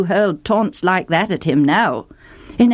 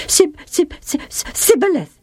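high, panicky stammer on "Sib-, sib-"; 9 semitones higher than usual. A narrator's reading. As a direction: stumble over "Sibboleth" in fear. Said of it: "stammered the fugitive".

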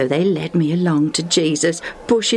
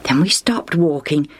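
plain reading voice

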